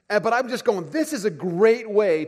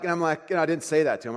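ordinary talk

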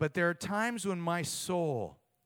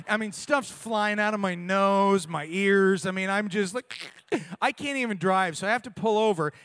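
loud emotional retelling; 3 semitones higher, 7 dB louder than usual. Retold or imitated. Retelling weeping hard while driving.

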